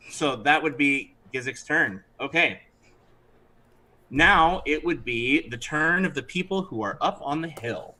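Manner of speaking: ordinary talk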